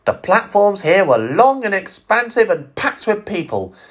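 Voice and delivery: ordinary delivery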